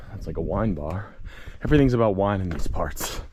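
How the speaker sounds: usual speaking voice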